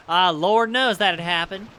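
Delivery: put-on slow Southern drawl, Southern accent. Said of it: "I have to fight my old Southern accent".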